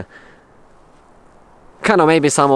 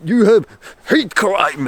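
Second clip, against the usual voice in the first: slow, higher mock police voice; hammed-up solemn cop, higher and slow; police officer; 7 semitones higher, slower than usual. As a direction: play the officer gravely serious, drawn out. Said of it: "they're like".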